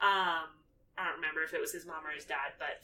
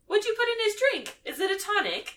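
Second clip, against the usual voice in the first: much higher, airy curious question; imitating Luna; 5 dB louder than usual. Luna asking about Ron's drink, much higher. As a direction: float the question, innocent. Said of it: "she's like".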